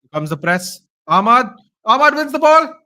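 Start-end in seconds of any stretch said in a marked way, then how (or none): 1.1-2.7 high, loud excited cheer. Real, not acted. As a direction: cheer the tackle. Fan cheering a tackle.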